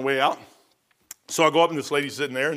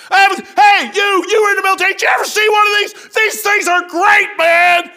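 imitated loud veteran; 10 dB louder than usual. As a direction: shout it like a gruff old airman thrilled by a tract. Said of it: "this is the way he's talking".

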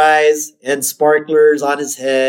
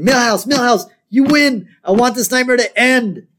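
put-on high, pleading kid voice; Bart; 10 semitones higher than usual. Bart banging on Milhouse's door, high desperate plea. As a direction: mock-desperate calling, pitched far up. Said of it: "And he's saying".

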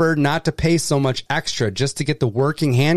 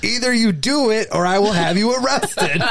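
higher-pitched mock security command; mock shouted command; 6 semitones higher than usual. Reader voices a security agent ordering a passenger, mock shouted command. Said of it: "he should shout".

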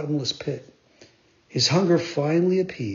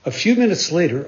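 plain reading voice

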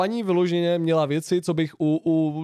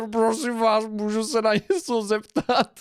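high, slow imitation of a pestering old woman; mock her odd voice, stretched out; weird old-lady voice; 6 semitones higher, slower than usual. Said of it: "nalepila se zase na mě a". (napodobený vysoký, protáhlý hlas staré paní)

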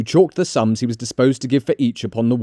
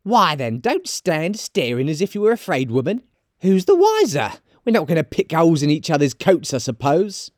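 high, defiant charwoman; high-pitched brazen woman; bold defiance; 6 semitones higher than usual. Acted. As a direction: challenge them in a high character voice. Charwoman challenges the others.

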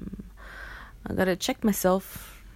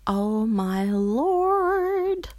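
high, campy drawn-out greeting; campy drag queen; 7 semitones higher, 3 dB louder, much slower than usual. Acted. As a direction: diva voice, theatrical.

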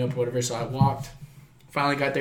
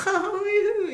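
much higher, teasing voice; coy flirty reply; 4 dB louder than usual. Retold or imitated. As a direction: play the flattered woman brushing it off sweetly. Mom's friend responding coyly.

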